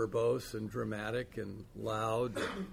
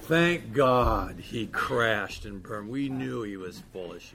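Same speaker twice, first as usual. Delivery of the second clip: mocking gloat; 7 dB louder, slower than usual.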